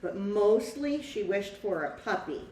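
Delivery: lively storybook read-aloud for a class; reading to children. A narrator's reading. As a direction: tell the story to the children, bright and clear. Teacher reads picture book aloud.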